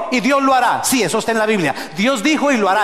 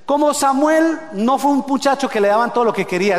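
ordinary delivery

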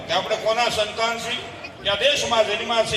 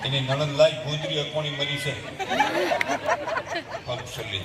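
low, hushed, anxious mother muttering to herself; worried mother demo; 4 semitones lower, 6 dB quieter than usual. Acted. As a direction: play a fretful mother whose daughter won't pick up. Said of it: "જરાક demo બતાવું છે". (ચિંતાતુર માતાનો ધીમો, ગભરાયેલો અવાજ)

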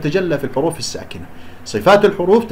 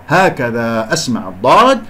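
normal talking voice

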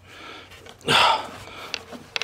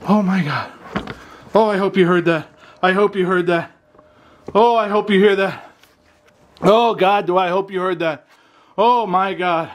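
excited; 5 semitones higher, 3 dB louder than usual. Real, not acted. Excited after hearing the sound.

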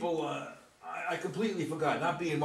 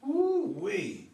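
woo-ee exclamation; 12 semitones higher than usual. Acted. Rembrandt marvels at Gramps' painting with a high whoop. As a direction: let out the old man's woo-ee. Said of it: "landing out with that woo-ee sound".

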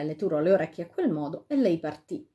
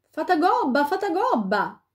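high, raised call at the cave; 9 semitones higher, 4 dB louder than usual. A narrator's reading. The king calls into the fairy's grotto. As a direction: summon the fairy from outside. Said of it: "cominciò a chiamare".

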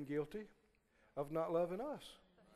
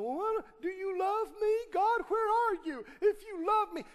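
much higher, mock pleading whine; mocking whiny doubter; 11 semitones higher, 3 dB louder than usual. Preacher mocking folk who whine at God, high whine. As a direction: mimic someone whining at God. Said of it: "ignorant folk look up and go".

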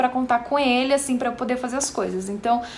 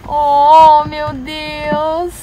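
tender cooing; 5 semitones higher, 6 dB louder, slower than usual. Real, not acted. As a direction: mom cooing to her teary son. Mom cooing to crying child.

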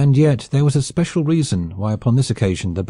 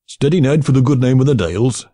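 raised Yorkshire comrade cheering his man; cried out; 3 semitones higher, 4 dB louder than usual. A narrator's reading. A Yorkshireman cheers on Ned before the shot, loudly. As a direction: urge Ned on, loud and high. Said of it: "cried a Yorkshireman".

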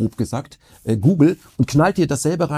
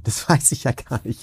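laughing; 3 semitones lower than usual. Real, not acted. Same words retaken with a laugh.

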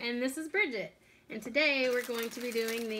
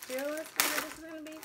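rushed, quieter repeat of the warning; fast teasing repeat; 2 semitones higher, 6 dB quieter, much faster than usual. Real, not acted. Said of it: "repeated warning, faster".

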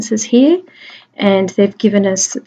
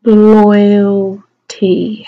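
"loyalty" drawn out very slowly, loud; "loyalty" with a long held LOY; stretched sounding out; 5 dB louder than usual. Shown as a demonstration. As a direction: feel through "loyalty" sound by sound. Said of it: "How are we gonna do that?".

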